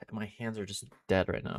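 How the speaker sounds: ordinary talk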